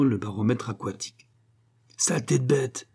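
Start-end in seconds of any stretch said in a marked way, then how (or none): 2.0-2.9 understated angry curse; furious cry. A narrator's reading. Husband at the cat that took his frog, furious. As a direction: curse with contained fury. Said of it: "cria monsieur Lahuche furieux".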